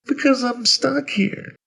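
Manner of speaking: put-on high, slow child voice; slow, very high childlike voice; little boy. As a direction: explain like a forlorn small boy. Stuck boy explains himself.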